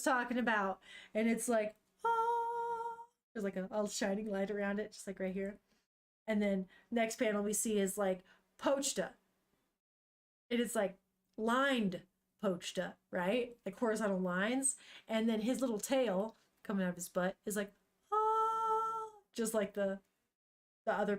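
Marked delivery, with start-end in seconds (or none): 2.0-3.1 sung glow sound. Retold or imitated. Voicing the manga's glow effect. 18.1-19.1 sung glow sound. Retold or imitated. Voicing the glow effect again.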